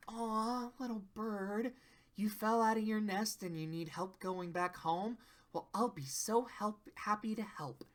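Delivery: put-on soft, hushed cooing to a baby bird; tender little voice, very quiet; gentle, sweet Fluttershy. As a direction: sweetly offer help, fading nearly to a whisper.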